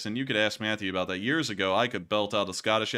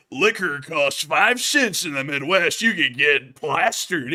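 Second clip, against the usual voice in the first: loud, higher, slow hammed-up announcer; mock TV presenter; 5 semitones higher, 8 dB louder, slower than usual. Streamer parodying a History Channel show, mocking and hammed-up. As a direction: belt it out slowly as a parody of TV.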